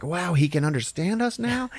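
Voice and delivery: high wondering question; high-pitched excited disbelief; amazed food chorus. Retold or imitated. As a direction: rise up high in mock amazement. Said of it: "they're like".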